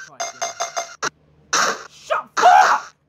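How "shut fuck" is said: much higher and louder, explosive; furious shout. Real, not acted. Player screaming after the ball breaks, furious shout. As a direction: blow up at the missed catch.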